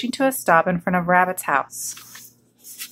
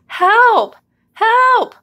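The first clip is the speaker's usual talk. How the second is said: high, loud distressed yelps; cry for help; 14 semitones higher, 6 dB louder than usual. A narrator's reading. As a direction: shriek for rescue in short bursts. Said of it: "cried Goose".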